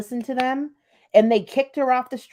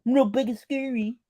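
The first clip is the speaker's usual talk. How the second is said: mocking wimpy voice. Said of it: "how unscary can you be?".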